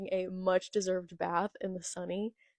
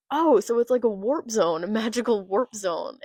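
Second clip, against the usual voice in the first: loud, eager imitation of a clueless captain; Luffy; 2 semitones higher, 7 dB louder than usual. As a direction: blurt it out with mock wonder, loudly. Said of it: "And Luffy's like".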